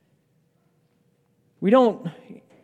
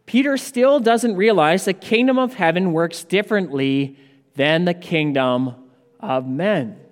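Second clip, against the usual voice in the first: ordinary talk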